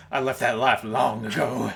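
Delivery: put-on a retired gunslinger, a touch of southern accent; southern cowboy voice. Joking along as retired Boba Fett, in a mock southern accent. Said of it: "Boba Fett has a southern accent now".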